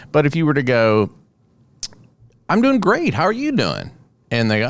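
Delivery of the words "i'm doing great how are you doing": much higher, bright cheerful greeting; cheerful hello; upbeat reply. Retold or imitated. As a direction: chirp it happily.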